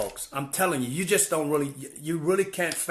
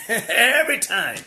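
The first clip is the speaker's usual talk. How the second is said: laughing; 9 semitones higher, 7 dB louder, slower than usual. Real, not acted. Laughs retelling her refusal.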